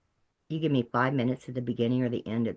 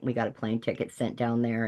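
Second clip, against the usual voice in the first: normal talking voice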